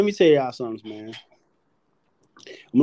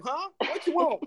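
mock Smokey; 11 semitones higher, 7 dB quieter than usual. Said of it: "He's like".

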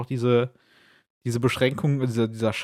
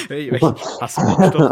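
laughing; 9 dB louder than usual. Pretend. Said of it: "Das hört sich falsch an".

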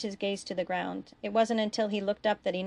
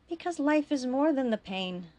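higher-pitched, heartfelt appeal; earnest urging; 6 semitones higher than usual. A narrator's reading. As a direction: urge your brother to hold on. Brother urging him to choose life.

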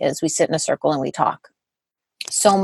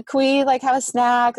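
put-on kids asking for a snack; 7 semitones higher than usual.

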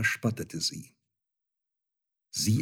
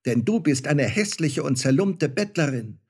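high, louder mock-furious outburst; angry shout; 6 semitones higher, 3 dB louder than usual. Star child rejecting his mother, shouting angrily. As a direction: snap at her in outrage. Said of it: "schrie das Sternenkind zornig".

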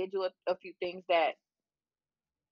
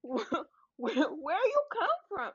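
mock incredulous; 9 semitones higher, slower than usual. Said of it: "that's also a toxic trait of asking".

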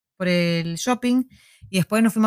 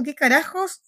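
higher, mock baffled question; imitated bewilderment; 7 semitones higher, slower than usual. Storyteller voices what the girl seemed to think, mildly bewildered. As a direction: lightly voice someone taken aback, pitch raised. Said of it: "como diciendo".

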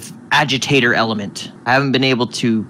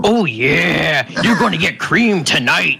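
hammed-up, drawn-out tease, pitched higher; giant whipped-cream bottle; 5 semitones higher, slower than usual. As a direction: play a talking cream bottle, hamming it up. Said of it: "Says the giant bottle of whipped cream".

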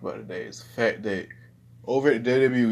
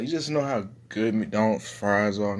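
ordinary talk, nothing marked

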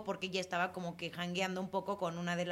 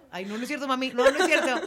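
loud laughing apology to mom; playful laughing retraction; laughing take-back; 4 semitones higher, 10 dB louder than usual. Real, not acted. Joking apology to her mom.

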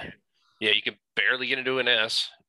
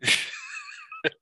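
high laugh; 3 dB quieter than usual. Real, not acted. Laughing at switched configuration.

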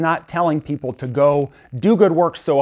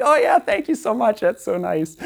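forced polite thanks; 8 semitones higher than usual. Retold or imitated. Polite thanks to lady in line.

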